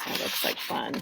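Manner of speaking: soft unvoiced aside; whisper. Real, not acted. Teacher whispers an aside to the listener. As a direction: breathe it under the reading.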